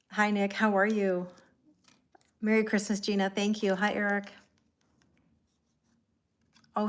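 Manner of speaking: ordinary talk, nothing marked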